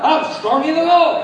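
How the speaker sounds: loud, high shout of faith; full-voiced triumphant cry; bold proclamation. Shown as a demonstration. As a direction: shout it out to the congregation. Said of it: "Say it like".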